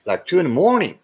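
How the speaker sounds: ordinary talk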